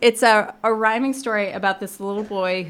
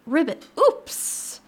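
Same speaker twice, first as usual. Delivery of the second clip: drawn-out, higher croaking ribbit; frog voice; 9 semitones higher, much slower than usual. Acted. Librarian voicing the fallen frog for listeners, playful frog voice. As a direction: play a frog tumbling in, stretched and high.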